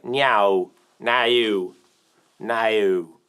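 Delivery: "no" stretched through many vowels, mock Australian. Streamer teasing Australian viewers about their vowels, mock Australian "no". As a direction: mimic an Australian "no" with gliding, crammed vowels. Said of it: "cram five vowels into the word no".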